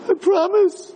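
put-on very high, whiny child's voice, pleading; pleading first grader. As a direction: beg like a little kid in trouble. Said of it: "First grader".

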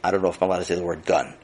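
usual speaking voice

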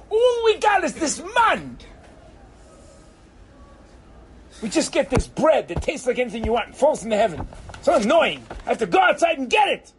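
high, exasperated griping; indignant grumbling, pitched high; whiny complaint. Acted. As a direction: gripe at a high pitch, fed up. Acted grumbling of the manna eaters.

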